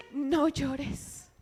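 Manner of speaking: suave y tierno, más bajo; tender comfort. Acted. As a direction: consuela con dulzura a la mujer. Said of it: "Jesús va y dice". ("No llores" dicho con ternura, en voz baja)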